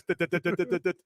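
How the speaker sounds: rapid staccato "dit" noises; imitated tapping. Patient showing how the nurse tapped, staccato imitation. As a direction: imitate quick, even taps with the voice. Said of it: "he's going like this".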